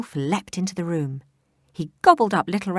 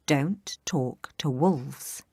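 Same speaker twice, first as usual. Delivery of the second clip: slow warning; much slower than usual. Acted. Rule recalled very slowly.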